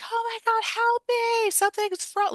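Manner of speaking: very high mock cry for help; shrill-pitched, hammed-up panic, no real volume; imitated screaming. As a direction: mimic screaming out through window bars. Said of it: "She's yelling through the bars".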